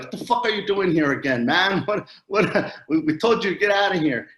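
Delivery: exasperated priests. Retold or imitated. Priests yelling at Juan Diego to get out, angry shout. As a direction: shout like priests sick of seeing him. Priests throwing him out again.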